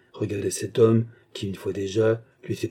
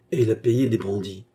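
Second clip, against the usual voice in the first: ordinary delivery, nothing marked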